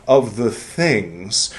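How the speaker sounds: slow, deliberate reading aloud; solemn reading. Acted. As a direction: read it like scripture. Reads the book aloud.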